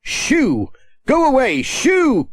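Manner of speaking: scare the bird away; shooing cry. A narrator's reading. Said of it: "cried Pat".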